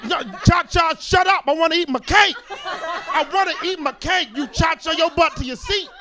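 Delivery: loud, high mock yelling at cha-cha; half-laughing exasperated shout. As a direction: yell at them to sit down. Yelling at dancing relative.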